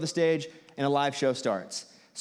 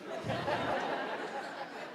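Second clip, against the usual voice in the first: a silent wheezing laugh; breathy laugh; 12 semitones higher, 10 dB quieter than usual. Real, not acted. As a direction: laugh without voice at himself. Laughs at awkward moment.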